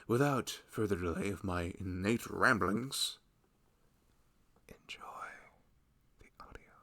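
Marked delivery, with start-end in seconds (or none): 4.7-6.7 soft whispered hand-off into the audio. Real, not acted. Creator whispering to listeners before the audio starts, hushed. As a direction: breathe the words, barely voiced. Signing off into the roleplay.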